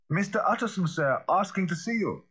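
calling out. A narrator's reading. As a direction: call through the door. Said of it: "he called".

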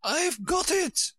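high, drawn-out queenly triumph; triumphant cry. A narrator's reading. Queen catching her shawl, high triumphant cry. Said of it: "she cried in a triumphant tone".

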